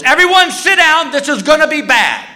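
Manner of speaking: high-pitched mock panic; freaking out. Flight attendant jumps up to warn passengers, freaking out. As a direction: cry it out in a hammed-up freak-out. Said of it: "until the flight attendant freaks out".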